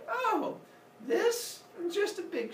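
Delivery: very high, coy singsong; hammed-up "who, me?" innocence; feigned innocence. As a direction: play down the weapon in a high voice. Kronos explains away his weapon.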